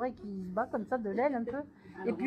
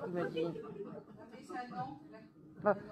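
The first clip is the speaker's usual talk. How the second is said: normal talking voice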